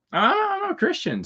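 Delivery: high, slow, prim protest; offended objection. Retold or imitated. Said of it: "they thought that was not funny".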